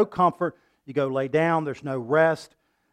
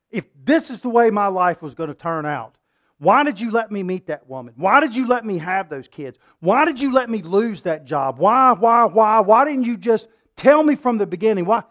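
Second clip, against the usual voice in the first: angry plea to God; 5 semitones higher, 6 dB louder than usual. Acted. Acting a bitter complaint to God.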